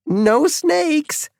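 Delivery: put-on very high, bubbly girl voice; a teenage girl tittering; giggly teen girl. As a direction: giggle the words out. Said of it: "the girl giggled".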